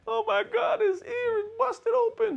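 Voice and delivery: high, squealing fake panic; shrill mock-hysterical cry; mock panicked owner. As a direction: play the owner freaking out over blood. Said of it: "now you wanna panic".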